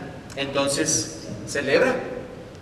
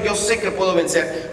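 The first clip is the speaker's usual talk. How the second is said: normal talking voice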